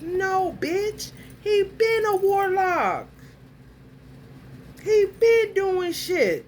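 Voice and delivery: high, forceful, drawn-out outrage; heated rant. Real, not acted. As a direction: pitch climbing as the rant builds.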